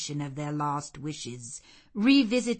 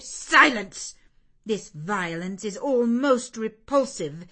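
loud, sharp rebuke; cold anger; 5 semitones higher, 8 dB louder than usual. Acted. Mrs Reed silencing the pleading girl, coldly angry. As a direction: shut her up with contempt. Aunt cuts off the child.

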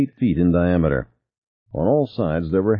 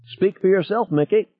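very high helium squeak; squeaky; 13 semitones higher than usual. A narrator's reading. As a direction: squeak it out like a cartoon character. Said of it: "Ted squeaked".

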